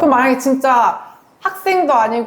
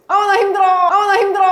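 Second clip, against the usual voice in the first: lonely outcry; 6 semitones higher, 3 dB louder than usual. Retold or imitated. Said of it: "메아리처럼 그냥 나 혼자 외침".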